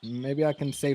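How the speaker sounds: ordinary delivery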